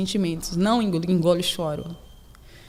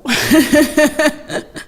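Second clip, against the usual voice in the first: loud laugh; 6 semitones higher, 7 dB louder than usual. Real, not acted. Laughs at "solidão.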